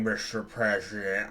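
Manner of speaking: slow, pompous fat tycoon greeting the president; drawn-out oily greeting from a rich man; smug tycoon voice. Acted. Tusk voiced in game.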